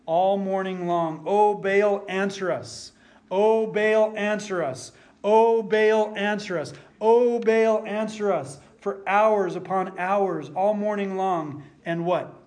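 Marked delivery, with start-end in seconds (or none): 1.3-8.6 loud pleading cries, rising on each Oh; crying out to Baal. Retold or imitated. Prophets of Baal begging their silent god, loud wailing. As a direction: wail Oh Baal over and over. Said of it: "crying out to Baal".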